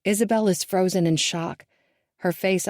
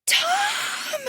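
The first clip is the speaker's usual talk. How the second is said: very high scream of his name. A narrator's reading. Mother screaming as her husband falls. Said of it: "I scream".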